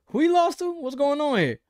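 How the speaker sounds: high incredulous "who?" pitch; mock disbelief. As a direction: act baffled at the loss. Mocking a loss to nobody.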